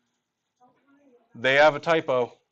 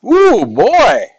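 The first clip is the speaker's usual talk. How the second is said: excited exclamation; 11 semitones higher, 9 dB louder than usual. Real, not acted. Teacher exclaims in class.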